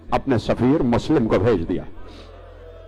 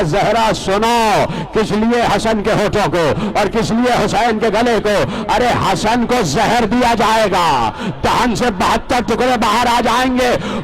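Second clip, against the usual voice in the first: rising higher and louder until a full shouted lament; preaching building to a shout; 7 semitones higher, 4 dB louder than usual. Acted. Preacher to mourners, building to a wailing shout. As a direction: build the sermon up to a cry over the dagger.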